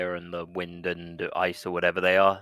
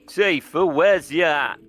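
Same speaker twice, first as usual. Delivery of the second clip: calling out; 6 semitones higher, 5 dB louder, slower than usual. Pretend. Calling out for Tifa.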